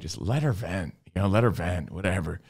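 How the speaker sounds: restrained murmur. Retold or imitated. Dad in the car holding back, quiet restrained murmur. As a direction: mutter it to yourself, calming down. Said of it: "I'm practicing all this restraint".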